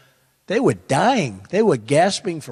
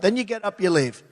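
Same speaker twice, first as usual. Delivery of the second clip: ordinary talk, nothing marked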